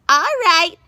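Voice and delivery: high, loud screechy witch; old witch calling the crowd to order; witch squawk. A narrator's reading. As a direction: squawk it to take charge. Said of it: "she squawked".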